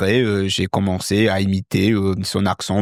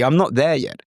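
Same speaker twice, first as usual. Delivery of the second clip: usual speaking voice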